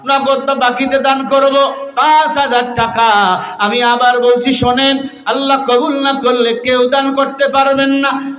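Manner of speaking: high, sustained preaching cry; impassioned fundraising. Real, not acted. Preacher asking for cash or pledges, impassioned high appeal. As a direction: press the appeal on a high held pitch.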